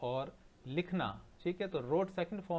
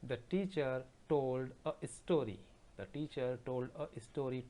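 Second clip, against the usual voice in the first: slow dictation; much slower than usual. Shown as a demonstration. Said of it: "teacher dictates sentence to class".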